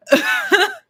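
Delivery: high, loud laugh; burst of laughter. Real, not acted. Cracking up at own joke about a chat message, loud high laugh.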